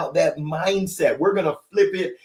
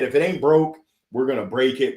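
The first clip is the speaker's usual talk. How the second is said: ordinary delivery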